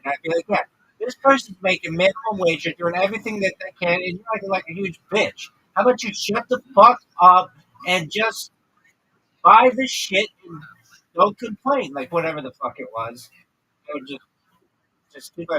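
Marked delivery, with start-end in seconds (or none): 0.5-11.9 rising to a loud, higher rant; lecturing a rude customer, louder and higher; mock telling-off.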